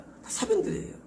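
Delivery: 사변들이에요 breathed out high and unvoiced, almost a whisper; breathy aside. Preacher dismissing human works, a breathy whispered 사변들이에요. Repeated refrain, second take.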